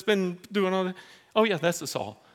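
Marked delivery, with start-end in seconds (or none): none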